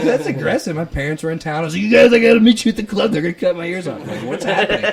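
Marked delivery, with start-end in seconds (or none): 0.0-0.8 high, loud, laughing retort; amused disbelief, high and loud. Retold or imitated. 1.8-4.0 panicked. Retold or imitated. Panicked call to parents. 4.2-4.8 panicked. Retold or imitated. Retelling his panic.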